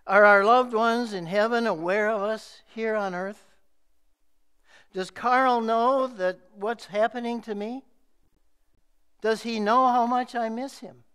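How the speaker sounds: urgent grieving questions read aloud; pained plea. Retold or imitated. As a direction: lift the voice, ask it aching and urgent. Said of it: "hear the pain in her pinched voice".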